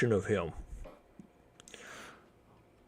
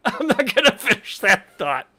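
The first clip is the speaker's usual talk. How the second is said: high, loud laughing speech; 13 semitones higher, 9 dB louder than usual. Real, not acted. Streamer abandoning a risky joke, laughing through it. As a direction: break up laughing mid-sentence.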